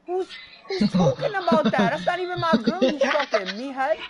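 loud, high angry retort; loud denial yelled at his friend; yelling back. Real, not acted.

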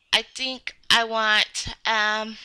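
ordinary talk, nothing marked

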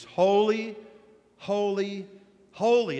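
high, loud, ringing holy, holy, holy; proclaiming. Acted. Seraphim calling to one another, loud proclamation. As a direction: call it out like angels crying praise.

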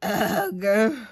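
drawn-out sickened "ugh"; acted groan of nausea; queasy groan. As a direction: groan with a belly too full. Hen finishing barrel of buttermilk.

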